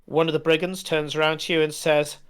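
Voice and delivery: usual speaking voice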